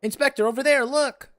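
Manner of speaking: high, loud excited cry; urgent calling out. Acted. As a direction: alert the inspector to a sudden sighting. Nashu calls out to inspector.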